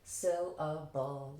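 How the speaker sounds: "Syllable" conducted in three slow beats. Shown as a demonstration. Teacher conducting the class with a finger, "Syllable" slow and evenly separated. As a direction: draw out "Syllable" like a maestro marking each part. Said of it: "like I'm a maestro".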